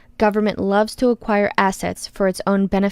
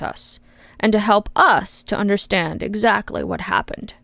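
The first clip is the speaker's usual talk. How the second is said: put-on loving father to toddler. Congressman explaining to the witness like a loving father. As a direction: talk down to her like a small child. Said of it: "tone of a loving father explaining to his toddler".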